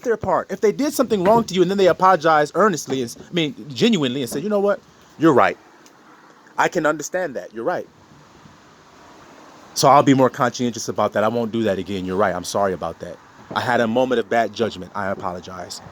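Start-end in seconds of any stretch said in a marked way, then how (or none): 4.4-15.8 meek apology. Acted. Partner voiced apologizing.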